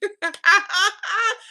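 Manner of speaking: high, loud burst of laughter. Real, not acted. Host laughing at Kim Bella's boast, loud and high. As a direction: crack up at the joke. Laughing at her own impression.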